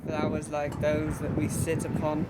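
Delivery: soft, halting mock-poetic recitation; old-fashioned poetry recital. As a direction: recite it softly as improvised antique verse, with long pauses. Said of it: "old-fashioned poetry".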